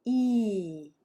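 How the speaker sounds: "E" stretched out in a falling arc; long vowel. Shown as a demonstration. Teacher demonstrating long vowel sounds, drawn-out "E". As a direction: hold "E" long, letting the pitch glide down. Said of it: "they're gonna be longer and there's kind of an arc".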